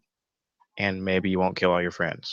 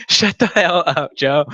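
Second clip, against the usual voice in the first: much higher and louder, cracking up mid-line; laughing protest; 8 semitones higher, 6 dB louder, slower than usual. Real, not acted. Telling roommate Joe to shut up while laughing. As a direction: laugh through a playful shut up.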